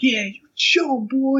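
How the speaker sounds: high, slow hyped-up cheer. Retold or imitated. Crowd cheering their guy after the fight, slow high cheer. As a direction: egg him on, drawn out and high. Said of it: "we're like".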